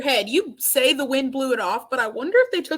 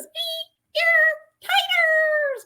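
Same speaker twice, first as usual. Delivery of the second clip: imitated niece; 12 semitones higher, much slower than usual. Imitating the niece sat next to him, high small-voiced cheer. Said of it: "she goes".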